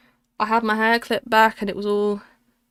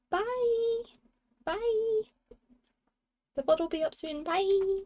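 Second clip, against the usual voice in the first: high goodbye; 13 semitones higher, 4 dB quieter, much slower than usual. Real, not acted. Waving goodbye to stream.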